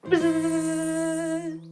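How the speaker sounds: long buzzing noise; playful buzz; bee buzz. Pretend. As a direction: buzz into the flower. Bee in flower.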